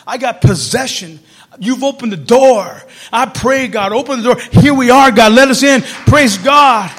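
slow, loud pleading prayer to God; fervent loud prayer. Retold or imitated. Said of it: "I said".

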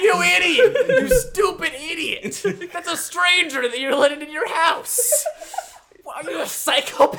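high, loud, laughing mock tirade; hammed-up ranting guy. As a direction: play the guy from Booksmart losing it over a kid's sign. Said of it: "That's the bit you're doing here".

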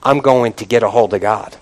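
low, softened, determined voice; quiet resolve. Acted. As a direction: settle down into hushed determination. David's resolve, after mock wailing.

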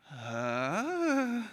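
long rising intake of shock; mock gasp, drawn out and rising; acted dismayed gasp. Golfer hears the window break, mock gasp.

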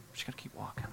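breathy hushed whisper. Acted. Preacher whispering to the congregation, hushed. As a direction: lean in and whisper it.

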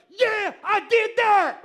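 loud, high victory shout imitating her; acting out a thrilled shout of success; excited. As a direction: burst out with a drawn-out, triumphant yell. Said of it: "Like, she's excited about it".

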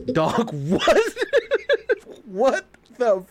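high-pitched, laughing disbelief; stunned "what?" broken up by laughter; incredulous. Retold or imitated. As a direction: retell a dumbfounded reaction, cracking up. Retelling his stunned reaction to "I'm British.

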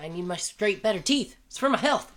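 high, lispy voice through dental trays; hammed-up lisp. Shown as a demonstration. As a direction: play up the lisped S and TH in "straight" and "health". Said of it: "it's hard to say S's".

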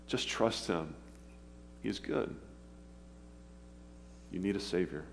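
ordinary talk

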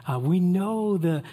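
ordinary talk

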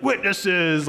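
crowd battle shout. Pretend. The crowd answering Boaz like an army, hammed-up battle roar. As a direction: bellow it back like a whole army. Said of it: "like, a Lord of the Rings battle".

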